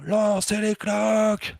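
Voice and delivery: high, loud, slow call to start; referee call. Retold or imitated. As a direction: call it out like a tournament referee to the hall. Arbitre lance la partie au tournoi. (annonce d'arbitre haute et forte)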